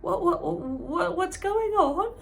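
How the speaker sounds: high, slow stammering confusion; baffled old lady sputtering; shocked. Acted. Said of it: "in shock".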